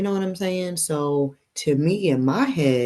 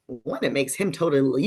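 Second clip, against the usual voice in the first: ordinary delivery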